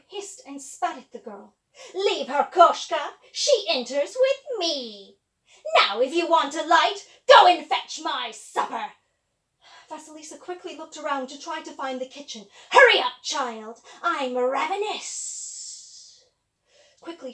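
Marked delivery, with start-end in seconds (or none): 1.9-8.9 loud commanding witch. Acted. 12.7-15.4 loud commanding witch. Acted. Witch demands supper.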